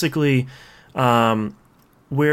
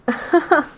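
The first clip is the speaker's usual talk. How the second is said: high giggle. Real, not acted. Laugh at character design.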